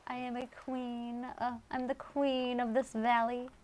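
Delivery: lightly hammed-up royal proclamation; regal queen. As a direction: play the proud queen of the valley. Wearing tiara, playing queen.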